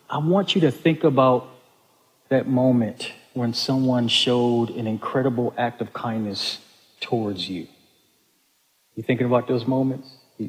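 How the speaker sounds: guided reflection. Acted. Pastor leading the congregation into quiet reflection, soft. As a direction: draw the room gently inward.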